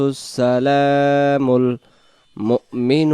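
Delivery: slow melodic tajweed chant; Quran recitation. Acted. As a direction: recite slowly with long drawn vowels.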